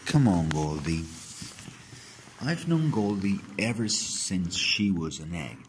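ordinary delivery, nothing marked

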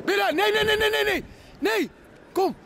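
high-pitched calling to a dog. Real, not acted. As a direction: call him back, pitch way up.

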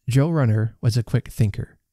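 usual speaking voice

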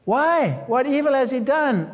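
high, pleading question; Pilate's plea. Retold or imitated. Pilate pleading with the crowd, high questioning. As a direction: ask it high, pleading for reason. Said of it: "And Pilate said".

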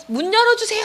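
high, held-back mock shout; retold cry out. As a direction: mimic a scared plea, pitch leaping up. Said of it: "하고 소리쳤는데".

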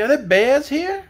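high, slow rising question; exclaiming. A narrator's reading. Dorothy asking about bears, alarmed. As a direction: ask it in alarmed surprise. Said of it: "exclaimed Dorothy".

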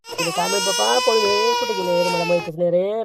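sobbing out the words in mock grief; tearful villager wailing over his runaway wife; crying lament. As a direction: weep through it like a deserted husband. Abandoned husband laments wife fleeing.

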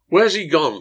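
angry crowd crying out for the missing Slade; anguished cry. Acted. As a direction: cry it out in frustration. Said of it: "the crowd cried out in anguish".

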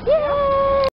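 high squealed yay; excited cheer. Real, not acted. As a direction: squeal it with delight. Cheering first stop.

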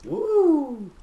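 high loud whoop; excited whoop. Real, not acted. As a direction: let out a big whoop. Cheer in game.

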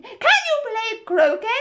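high, loud, drawn-out bellow; shouted question. A narrator's reading. Queen puts a question to Alice in a shout. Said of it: "shouted the Queen".

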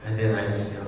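ordinary talk, nothing marked

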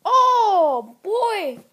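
loud excited exclamation. Real, not acted. Kid reacting to a crash in the game, loud excitement. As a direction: burst out at the crash.

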